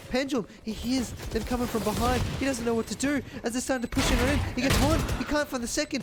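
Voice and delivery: high, loud, rapid-fire esports casting; hyped shoutcaster riding the clutch round; live play-by-play. Acted. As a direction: call every kill like the crowd is on its feet.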